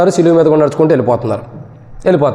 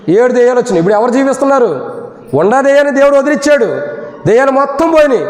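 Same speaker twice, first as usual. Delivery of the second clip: preaching at full force; 9 semitones higher, faster than usual. Acted. Preacher rises again.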